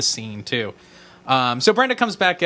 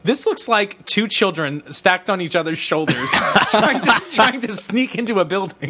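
laughing; 5 semitones higher than usual. Real, not acted. Laughing through own joke.

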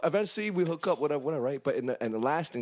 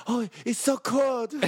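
higher, slow, prissy little complaint; mock shivering girl; 9 semitones higher, slower than usual. Teller mimics the girl stepping out, high and slow. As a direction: whine it up high like she's freezing. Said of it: "like, all like".

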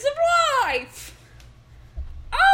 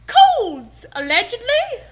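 higher, louder hammed-up stage pirate; pirate accent; 5 semitones higher, 4 dB louder than usual. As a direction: play the salty pirate hawking vitamins. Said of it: "I'm so good at accents".

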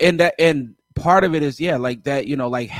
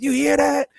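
much higher, hyped-up mimicry; 11 semitones higher than usual. Retelling a producer urging others to listen, hyped. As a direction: imitate an excited producer showing off. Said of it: "[name] was hype on that one".

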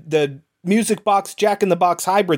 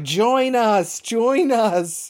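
high, drawn-out call of dead friends; ghostly beckoning; 7 semitones higher, much slower than usual. Retold or imitated. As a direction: imitate phantoms luring someone in. Voices hallucinated dead friends beckoning.

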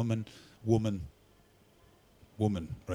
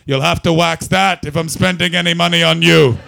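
loud, higher Glaswegian heckle; Glaswegian accent; 4 semitones higher, 13 dB louder than usual. Retold or imitated. Glaswegian from an alley heckling the singer, thick accent. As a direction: call it out as a rough Glaswegian. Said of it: "A Glaswegian man emerged from an alley".

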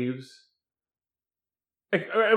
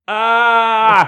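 loud angry yell of outrage; enraged yell; 3 semitones higher, 9 dB louder than usual. Retold or imitated. Johnny smashing things in the house, furious yell. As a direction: bellow it in a rage. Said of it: "the equivalent of this".

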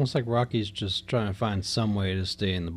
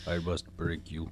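put-on Russian accent; 6 semitones lower, 7 dB quieter than usual. Quoting Drago from Rocky IV.

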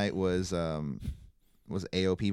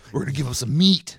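imitating Heavy Machinery; 6 semitones higher, 8 dB louder than usual. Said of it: "Heavy Machinery's video package".

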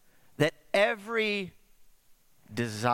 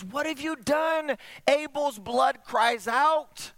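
high, drawn-out cry of accusation; accusing outcry; 9 semitones higher, slower than usual. Acted. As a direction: cry it out slowly, like an appalled God confronting Cain. God confronts Cain after murder.

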